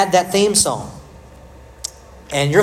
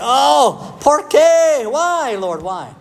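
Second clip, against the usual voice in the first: mock anguish; 8 semitones higher, much slower than usual. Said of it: "I'm thinking".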